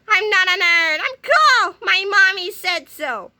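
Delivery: high, whiny protest; high whiny childish protest; whiny giant nerd. Acted. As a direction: insist like a sulky child.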